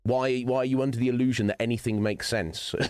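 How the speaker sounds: ordinary talk, nothing marked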